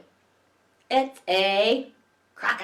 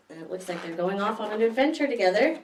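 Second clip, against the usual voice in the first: plain reading voice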